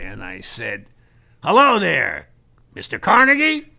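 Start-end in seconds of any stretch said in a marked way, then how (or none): 1.4-3.7 bright, loud hello; cheery greeting. Retold or imitated. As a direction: greet him brightly on the phone.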